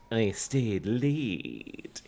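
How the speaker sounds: very slow, soft narration; quiet. A narrator's reading. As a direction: say it quietly and slowly. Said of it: "he said quietly".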